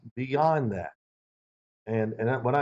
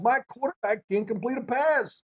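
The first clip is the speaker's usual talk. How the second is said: complaining coach; 9 semitones higher than usual. Retold or imitated.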